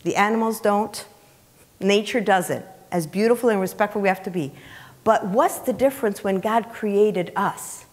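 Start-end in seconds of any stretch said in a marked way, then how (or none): none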